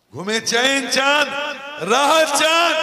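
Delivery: high, drawn-out full-voice cry; soaring, very loud sermon call; rousing preacher's call. Real, not acted. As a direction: belt it out to rouse the congregation. Rousing the congregation with questions.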